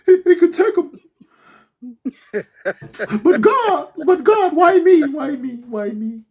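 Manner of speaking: high, loud hammed-up wail; mock lament. Job crying out to God, mock wailing. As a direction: wail to heaven, half joking.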